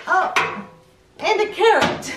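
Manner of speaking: high, loud, laughing "oh". Real, not acted. Finding a toy carrot, high and laughing.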